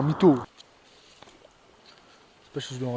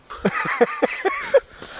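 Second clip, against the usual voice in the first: high shrieking laugh; high burst of laughter. Real, not acted. Laughing at friend's remark.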